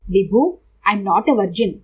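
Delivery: very slow, every word weighted; slow, each word pressed. Acted. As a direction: spell it out one word at a time. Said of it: "అతి మెల్లిగా ఒక్కొక్క మాట ఒత్తి పలికాను".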